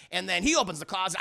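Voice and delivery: ordinary talk, nothing marked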